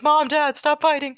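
high-pitched mock whine of a kid; put-on little kid's plea; pleading child. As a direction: beg like a child caught between squabbling parents. Voices child pleading with squabbling parents.

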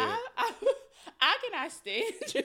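so amused the words barely come out; laughing. Real, not acted. Cracking up at the story.

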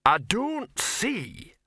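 high, very slow troll voice; protesting troll. A narrator's reading. Troll starting to protest among bickering dwarfs, slow indignation. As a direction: begin the objection ponderously, stretching each word.

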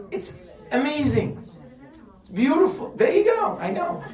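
high and bright with delight, laughing; rising giddy wonder, breaking into a laugh; excited. Real, not acted. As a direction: gush with amazement, voice jumping up high. Excited over animal clothing.

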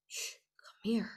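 hushed secret whisper. Retold or imitated. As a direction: breathe it like a secret call across a crowd. Awakened girl calling children secretly.

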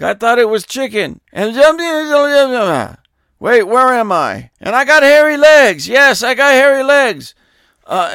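wander off mid-thought like a confused elder statesman; loud, high mock-presidential ramble; Joe Biden impression. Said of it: "I was imitating Joe Biden".

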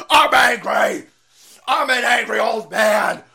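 hammed-up rage at the lectern; screaming angry old man. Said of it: "he's screaming at the lectern".

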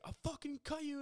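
very high-pitched mock threat; imitated anger. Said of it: "he's, like, really angry".

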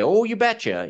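high, bright North Dakota oh you betcha; North Dakota accent. Retold or imitated. Host imitating North Dakotans in a hammed-up accent. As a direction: sing it up like a Minnesota-Dakota local. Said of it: "expecting everybody to be".

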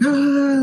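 high mock yelp of pain; a quick high hammed-up ow; cop's pained cry. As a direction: imitate a cop hit by a scream. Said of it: "the cop's like".

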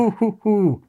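high playful monkey hoots; sung ape "ooh"s; ape hooting. Acted. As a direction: hoot like a scatting ape. Ape noises within the song.

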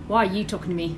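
low, put-on Australian accent; lightly hammed-up Aussie accent. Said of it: "What's with the Australian accent?".

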